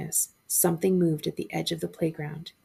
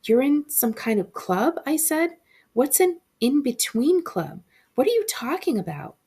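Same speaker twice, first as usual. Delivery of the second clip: higher, louder nervous questions; desperate questions; 8 semitones higher, 4 dB louder than usual. A narrator's reading. Boy desperately questions the shadow kids, high and louder. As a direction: grasp for an explanation.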